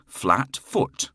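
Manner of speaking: ordinary delivery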